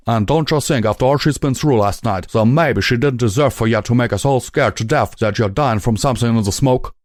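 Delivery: a touch of righteous anger; mild scolding stallion. A narrator's reading. Calamity reproaching.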